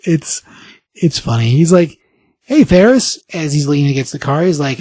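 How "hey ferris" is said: mock-smug greeting, pitched up. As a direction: greet an old flame cockily. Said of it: "smug as always".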